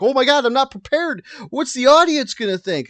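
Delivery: high, loud retold fretting; mock panic. Host retelling their reply to girlfriend, mock panic. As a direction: replay the panic, hammed up and high. Acted own panic before recording.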